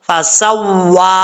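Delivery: फसव्वा recited slowly with rounded lips on the वा; tajweed recitation of फसव्वा. Shown as a demonstration. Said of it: "होंठ गोल करके पढ़ेंगे".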